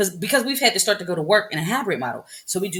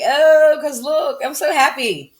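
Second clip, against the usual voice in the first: excited; 8 semitones higher, much slower than usual. Retold or imitated. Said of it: "I'm like".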